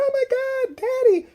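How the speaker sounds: very high-pitched imitated girl's scream; mock scream. Girl finds her dead daddy, mock high scream. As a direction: shriek it as a hammed-up horrified daughter. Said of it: "So the girl's screaming".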